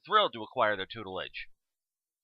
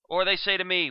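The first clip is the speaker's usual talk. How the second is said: ordinary talk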